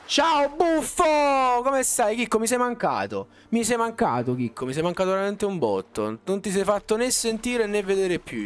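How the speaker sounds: greeting a returning viewer with excitement; excited greeting. Real, not acted.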